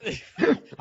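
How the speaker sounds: slow, high mock ninja growl into a laugh; playful ninja line dissolving in laughter; hammed-up ninja voice. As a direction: drag it out as a silly ninja. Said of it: "I went all ninja on you".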